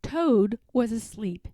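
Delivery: ordinary delivery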